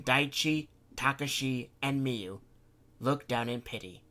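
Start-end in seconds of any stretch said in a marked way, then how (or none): none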